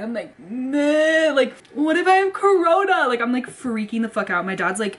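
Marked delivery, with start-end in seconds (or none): 0.4-3.1 high, whiny mock sob on each word; imitating crying. Retelling her panic to viewers, high whiny mock crying. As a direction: whimper it like a scared kid. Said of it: "I just start crying".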